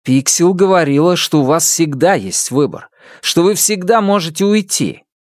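lightly voiced, rising young accuser; accusing young man. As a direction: press him hard with questions. Isidore argues back.

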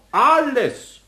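ALLES drawn out high on a falling tune; emphatic answer. Acted. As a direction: stretch ALLES, starting high and dropping. Emphatic answer about mouse damage. (nachdrücklich gedehntes „Alles“)

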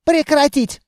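high, outraged order; indignant command. A narrator's reading. Officer orders the carter to stop, indignant. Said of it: "возмутился эльф-офицер".

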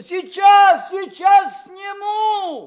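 loud, high, drawn-out shout; calling out. Acted. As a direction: shout it up to the treetop, stretching the last word. Дед кричит девочке на дерево. (громкий протяжный крик вверх)

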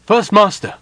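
high, loud cry of alarm; booming panicked call. A narrator's reading. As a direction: call out urgently over the din. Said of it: "Lady Camilla's voice boomed".

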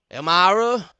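loud, high bossy call. Acted. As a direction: bellow her name up from below. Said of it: "sings out Hank".

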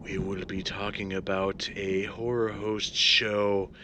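low spooky horror host voice; jack o lantern voice. Acted. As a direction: drawl it out like a ghoulish host. Spooky cold open before host intro.

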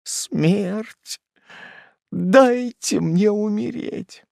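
despairing plea. A narrator's reading. Despairing mother.

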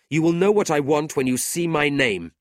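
high, loud, angry declaration; indignant. A narrator's reading. Brother handing his card to insulter, indignant. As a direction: announce it as a challenge.

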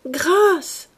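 high, loud begging; desperate cry. A narrator's reading. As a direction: beg for mercy at full voice. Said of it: "répéta Gwynplaine".

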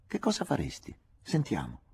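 "Che cosa faresti?" alzato in tono molto acuto e provocatorio; Micol provocatoria. A narrator's reading. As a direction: Micol lo sfida, leggermente beffarda. Micol lo sfida. (Micol che lo sfida, voce acuta e sommessa)

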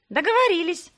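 high, loud, gleeful shout together; shouted in chorus. A narrator's reading. The twins agree with Irzhina together, gleeful chorus shout. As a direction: shout it out as one, thrilled. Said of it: "Это девчонки выкрикнули хором".

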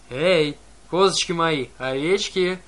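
loud slow bellow calling the herd; bellowing roar. A narrator's reading. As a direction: roar it out slowly like a giant. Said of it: "циклоп проревел".

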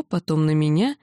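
usual speaking voice

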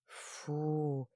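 long exhaled phew of relief; relieved sigh. A narrator's reading. Onlookers as the danger passes, relieved exhale. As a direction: breathe out in relief. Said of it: "с облегчением выдохнули".

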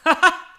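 a loud, high scornful laugh; mocking laugh. Elektra laughs scornfully at her frightened mother. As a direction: laugh her question away.

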